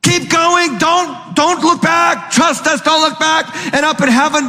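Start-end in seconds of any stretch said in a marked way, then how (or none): 0.0-2.8 cheering voices. Acted. Heavenly voices cheering Lot on. 2.8-3.5 high-pitched pleading call, don't look back; urging voices. Acted. Voices urging Lot onward.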